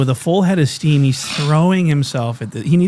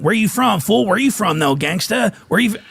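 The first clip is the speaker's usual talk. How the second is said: high mock gangster challenge; imitating a hostile street challenge; gang member; 7 semitones higher than usual. Friend imitating a gangbanger demanding where you're from. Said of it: "but he was saying".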